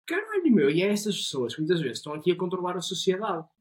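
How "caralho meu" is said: sudden high, loud exclamation; exasperated disbelief. Retold or imitated. Reacting to the depressing hazing guy, a high loud gasp of disbelief. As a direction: blurt it out, much higher and louder. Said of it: "E eu fico tipo".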